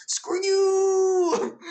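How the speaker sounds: high, loud, drawn-out taunt; mock defiant shout. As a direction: stretch it into a sing-out jeer. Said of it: "and goes".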